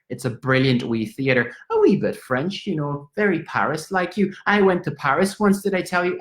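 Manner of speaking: put-on high, sing-song old Irish lady; pry cheerfully like a neighbour; a high, curious Dublin landlady; nosy Irish landlady. Landlady asks where he goes.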